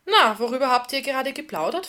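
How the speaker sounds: upbeat and inquisitive; breezy inquiry. A narrator's reading. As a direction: open the conversation jovially. Bernd opens chat breezily.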